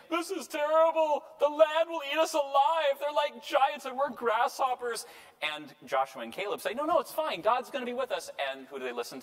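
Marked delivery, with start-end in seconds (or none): 0.1-5.0 high, loud hammed-up alarm; wailing doom-monger, pitched way up; panicked spies. Ten spies' panicked report acted out. 6.7-8.3 high-pitched, eager mock reassurance; acted-out optimist, pitched high; reassuring spies. Joshua and Caleb reassure the people.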